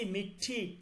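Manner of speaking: "মিঠি" with a long, aspirated ঠ; carefully modelled "মিঠি", ঠ held before release; aspirated retroflex ঠ. Shown as a demonstration. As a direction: curl the tongue back and release a puff in "মিঠি". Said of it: "ঠ এর উচ্চারণ".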